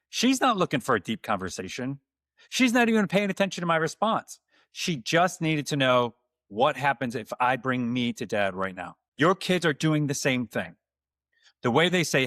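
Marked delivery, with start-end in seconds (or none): none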